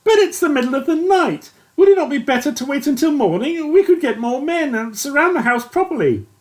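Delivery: a nervous officer objecting; lightly voiced worried captain. A narrator's reading. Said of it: "but Yates intervened".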